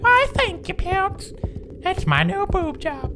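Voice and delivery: squeaky falsetto character voice; tiny, piercingly high puppet-like voice; Jimmy. Acted. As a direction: put on a very high cartoonish voice. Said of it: "My name is Jimmy".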